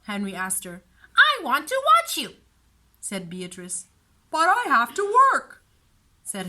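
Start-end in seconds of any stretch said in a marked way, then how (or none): 1.2-2.4 put-on high, loud whiny child voice; a stubborn little sister refusing; whiny little girl. A narrator's reading. Beatrice voiced by narrator. 4.3-5.6 put-on higher, louder pleading boy voice; exasperated big brother. A narrator's reading.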